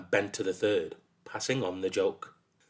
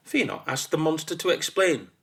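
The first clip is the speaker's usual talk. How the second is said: usual speaking voice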